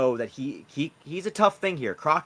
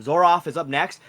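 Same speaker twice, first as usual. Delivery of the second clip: usual speaking voice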